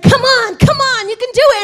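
high, loud encouragement; rally them like a coach; cheering on. Retold or imitated. Coach cheering her trainees loud and high. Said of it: "I was like".

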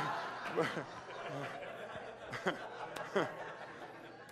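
real, long breathy crack-up; helpless laughter. Real, not acted. Preacher cracking up at his own impersonation, helpless laughter. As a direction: dissolve into wheezy laughing.

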